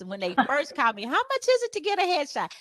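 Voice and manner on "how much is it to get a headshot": high-pitched caller asking about a headshot; mock caller. Client phoning for a headshot, high mock caller voice. Client calling asks price.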